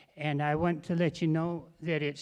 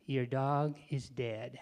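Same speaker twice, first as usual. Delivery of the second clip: solemn; 4 semitones lower, 6 dB quieter, slower than usual. Acted. Vet delivers bad news.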